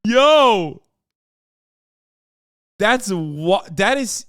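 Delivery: high, loud stunned outburst; shocked yell. Real, not acted. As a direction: blurt it out in disbelief. Streamer reacts to [name] claim.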